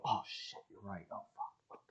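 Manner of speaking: whispered sudden realization; hushed mock panic. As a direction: whisper it, imitating your own dawning panic. Acts out his own sudden panic.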